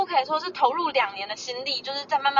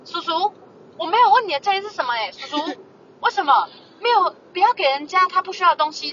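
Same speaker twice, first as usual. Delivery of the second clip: louder, indignant rebuke of the uncle; retold exasperated retort; 5 semitones higher, 4 dB louder than usual. To the preachy uncle, an exasperated raised-voice rebuke. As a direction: talk back at the preachy uncle.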